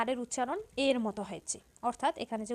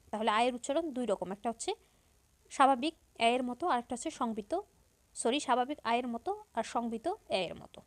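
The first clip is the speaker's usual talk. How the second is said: normal talking voice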